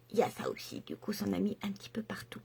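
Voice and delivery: half-whispered confession, barely voiced; whispered aside. Real, not acted. Telling viewers her daughter dropped the palette, in a whispered aside. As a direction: drop to a hushed aside, almost breath only.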